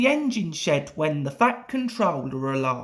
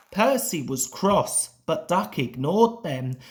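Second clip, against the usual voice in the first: usual speaking voice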